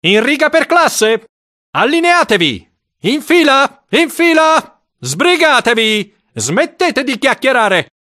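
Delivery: high, ringing shouts; shouted orders. A narrator's reading. Deputy head lining up pupils in the yard, shouting orders. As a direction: shout pupils into line, pitched right up. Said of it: "il vice direttore gridava".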